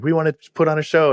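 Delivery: put-on high, fast excited kid voice; kids putting on a show. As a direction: squeal it like an eager little kid. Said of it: "They're like".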